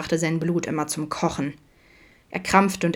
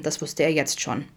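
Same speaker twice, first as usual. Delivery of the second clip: ordinary delivery, nothing marked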